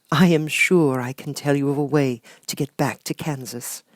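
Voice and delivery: high, gentle sorceress voice; kind witch Glinda. Acted. Glinda promising Dorothy a way home, high and gentle. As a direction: soothe the child like a kind fairy.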